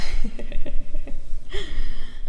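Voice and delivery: loud, breath-filled laughing fit; real amused laugh. Real, not acted. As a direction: burst out laughing. Amused at indecisive cards.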